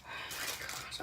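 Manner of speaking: barely voiced, breath-only amazement; real hushed astonishment; awed whisper. Real, not acted. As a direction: whisper it in awe. Overwhelmed by the cute item.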